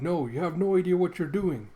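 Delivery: loud, high, urgent protest; alarmed shout. Acted. Old man yelling at the half-wolf in alarm. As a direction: shout a frantic warning. Elder panics as armor closes.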